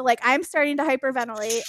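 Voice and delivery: ordinary delivery, nothing marked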